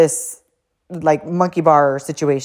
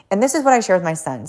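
ordinary talk

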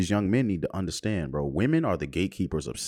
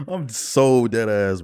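laughing; 9 semitones higher, 6 dB louder, much slower than usual. Real, not acted. Laughing after the bit.

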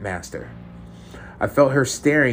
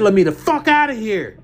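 much higher and louder, yelling the demand; angry shout; 11 semitones higher, 5 dB louder than usual. Acted. Chained captive yells at the woman behind the light, furious. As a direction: shout it at the captor this time, furious.

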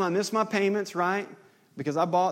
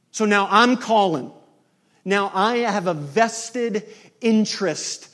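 loud, high pleading sermon; fervent preaching; 5 semitones higher, 7 dB louder than usual. Acted. Preacher urges listeners to reconcile, pleading loudly. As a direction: plead with the congregation at full force.

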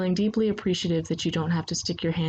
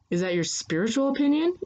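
higher, mildly wounded question; a touch of hurt, pitched up; a little hurt; 8 semitones higher than usual. A narrator's reading. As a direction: ask as if slightly stung. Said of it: "a little hurt".

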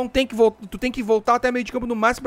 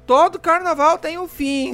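high, slow theatrical sigh; whiny mock voice; 8 semitones higher, 7 dB louder, much slower than usual. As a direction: whine like an annoying complainer. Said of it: "Não aguento aqueles caras que já começam".

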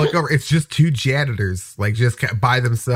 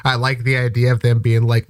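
ordinary delivery

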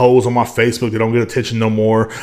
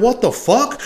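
acted shock; 10 semitones higher, slower than usual.

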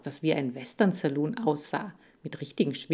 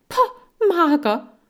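very high, loud contemptuous pah, mager; outraged hamster squeak; scoffing hamster; 12 semitones higher, 8 dB louder, slower than usual. Acted. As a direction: spit it back in disgust.

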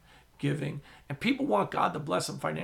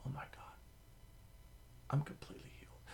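breathless hush of wonder; awed whisper; 15 dB quieter, faster than usual. Retold or imitated. Healed woman whispering her amazement at the meeting. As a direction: whisper it, barely voiced.

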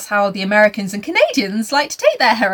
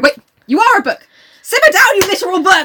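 loud, high mock scolding of a book; shrill-free hammed-up telling off; 8 semitones higher, 6 dB louder than usual. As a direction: tell the book off.

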